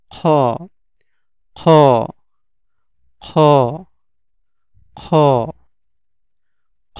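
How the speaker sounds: "খ" scraped from the end of the throat; back-of-throat sound. Shown as a demonstration. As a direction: rasp "খ" from the back of the throat, one at a time. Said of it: "শেষ, এইখান থেকে উচ্চারণ হবে".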